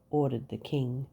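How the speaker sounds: ordinary delivery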